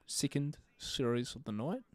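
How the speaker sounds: put-on slow, careful New Zealand caster accent; New Zealand accent try. Streamer copying the New Zealand casters, slow New Zealand accent. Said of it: "This accent's really hard".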